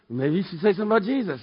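quick, small nagging conscience; little inner voice. Acted. Voice in the back of his head, quick small nudge. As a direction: slip it in as a quick little prompting. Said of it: "this little voice goes".

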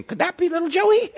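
high, squeaky mock question. Brothers wondering about Joseph in a squeaky high voice. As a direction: pitch way up like a silly brother.

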